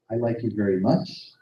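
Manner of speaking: ends rising like a question; rising question tune. Shown as a demonstration. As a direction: lift the end so it asks. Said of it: "it sounds like a question".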